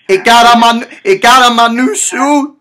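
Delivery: loud, high wail about the shoe; rising outrage. Acted. Prank caller escalating over shoe.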